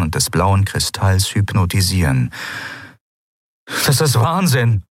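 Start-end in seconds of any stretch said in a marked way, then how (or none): 3.9-4.8 called out. A narrator's reading. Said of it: "rief er".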